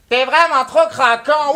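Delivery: slow, drawn-out mock Québécois accent; mock a Montreal girl in a Québécois accent. French rapper quoting a Montreal blonde, mocking her Québécois accent. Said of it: "C'est quoi cet accent de merde".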